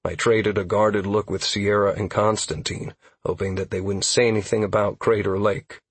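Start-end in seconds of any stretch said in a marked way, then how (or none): none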